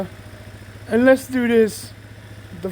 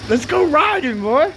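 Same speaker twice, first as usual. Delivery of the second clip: gym bro; 4 semitones higher than usual. Real, not acted. Hyped to ride the bike.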